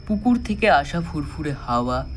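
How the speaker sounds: ordinary delivery, nothing marked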